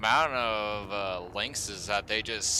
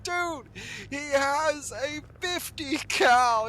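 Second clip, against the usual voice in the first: very high, slow squeaky voice; mock squeaky buddies; 4 dB louder, slower than usual. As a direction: squeak it as his worried tick friends. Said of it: "And they're like".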